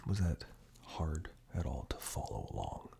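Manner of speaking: ordinary talk, nothing marked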